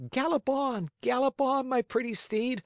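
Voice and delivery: put-on high, merry child voice; high-pitched playful puppet call. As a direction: urge the steed on gleefully. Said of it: "he cried out gaily".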